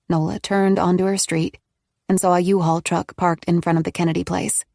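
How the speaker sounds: plain reading voice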